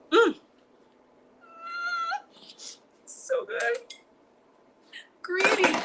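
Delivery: high, loud yummy squeal; delighted squeal. Real, not acted. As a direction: squeal with pleasure at the taste. Tasting the whipped cream.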